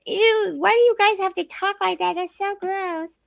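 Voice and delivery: put-on high squeaky little-girl voice, slowing on the last line; toddler daughter. Mother imitating her little daughter's disgust, high and squeaky. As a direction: mimic a disgusted small girl. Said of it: "Autumn came along and she".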